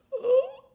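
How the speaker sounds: a sharp, high gasp of horror; high startled gasp at the empty shelf; shocked gasp. Retold or imitated. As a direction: gasp as if the world just ended.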